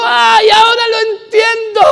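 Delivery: loud, high cry of discovery; excited realization. Acted. Matthew crying out, suddenly understanding the prophecy. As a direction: cry out as the truth finally dawns.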